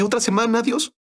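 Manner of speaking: high, incredulous question; a squeak of mock dismay; dismayed disbelief. Pastor balks at praying another week, high incredulous squeak.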